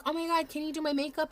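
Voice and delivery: high, fast excited plea; mock a gushing fan begging for makeup; gushing request. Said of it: "People would be like".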